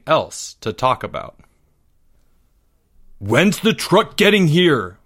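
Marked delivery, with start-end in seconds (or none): 3.2-5.0 high, slow, drawn-out whine; a spoiled kid whining. A narrator's reading. Greg to his mom, impatient for the game truck, whining. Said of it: "whined Greg".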